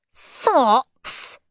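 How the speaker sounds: high disgusted yelp; screamed. Acted. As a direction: spit out the word in disgust. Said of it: "screamed Stuck-up Steve".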